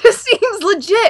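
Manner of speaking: high, slow cartoon voice through a laugh; cartoon DNA voice. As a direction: echo the bit as the cartoon helper. Echoing the DNA cartoon bit.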